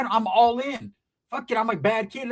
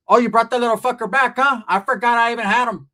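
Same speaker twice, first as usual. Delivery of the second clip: louder, higher mock surprise; uncaring aunt; 4 semitones higher, 5 dB louder than usual. Aunt seeing her son return, dismissive mock surprise. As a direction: blurt it as a careless mother.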